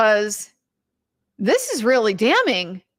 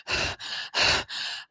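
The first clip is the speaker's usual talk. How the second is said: heavy breathing; 8 dB quieter than usual. Retold or imitated. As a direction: imitate hard, anxious breaths. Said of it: "like breathing extremely hard".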